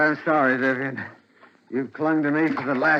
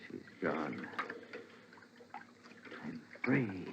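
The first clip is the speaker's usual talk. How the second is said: hushed relief; 11 semitones lower, 19 dB quieter than usual. Acted. Husband alone after drowning wife.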